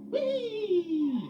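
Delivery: very high, loud falling whee; gleeful whee. Acted. Freddie whizzing across the depot, gleeful whee. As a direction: shout for joy while skidding. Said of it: "he went".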